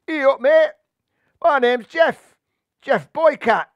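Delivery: loud, high Yorkshire cat; cat leader announcing his name; Yorkshire accent. Acted. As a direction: bellow it in broad Yorkshire. Yorkshire cat introduces himself.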